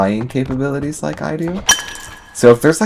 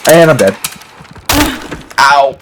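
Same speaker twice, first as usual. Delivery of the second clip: loud yelp; 4 semitones higher, 7 dB louder than usual. Real, not acted. Sudden death in game.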